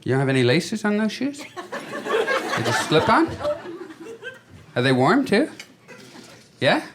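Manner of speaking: high mocking voice; teasing. Real, not acted. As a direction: tease the shoes, high. Heckling audience member's shoes.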